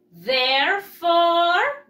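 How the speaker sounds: Therefore slow, loud and drawn out; stress on Therefore. Shown as a demonstration. Teacher highlighting the adverb for pupils, loud and slow. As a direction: lean hard on Therefore. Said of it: "stressing the key adverb".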